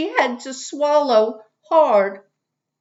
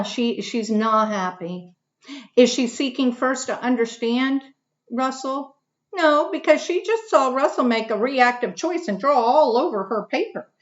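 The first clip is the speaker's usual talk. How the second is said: ordinary delivery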